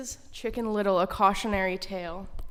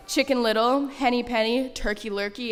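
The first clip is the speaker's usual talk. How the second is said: normal talking voice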